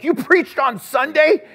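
loud, high, drawn-out disbelieving question; astonished. Retold or imitated. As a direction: echo it back in acted astonishment. Retelling astonished reply to friend.

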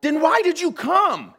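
high, loud incredulous question; exasperated challenge. Retold or imitated. Pastor retelling his argument with two officers, exasperated. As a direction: throw it back at them, pitch jumping up.

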